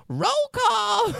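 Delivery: very high sung-out announcer call; hammed-up high roll call; mock fanfare call. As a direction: belt it out like a fanfare. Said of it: "comes into a fanfare with".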